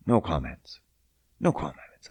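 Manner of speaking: hushed mock hotel owner; quiet, low brush-off; imitated evasive reply. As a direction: drop the voice, dodging the question.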